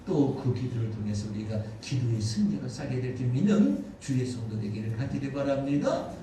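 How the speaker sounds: ordinary talk, nothing marked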